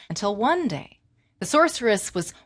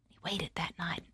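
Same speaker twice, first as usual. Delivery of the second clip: whispered; 5 semitones lower, 13 dB quieter than usual. A narrator's reading. Suspense narration.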